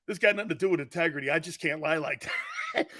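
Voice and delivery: normal talking voice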